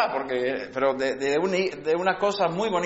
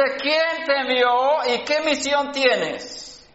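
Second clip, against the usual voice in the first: rising rebuke; 7 semitones higher than usual. Acted. Preacher challenges the proud leaders.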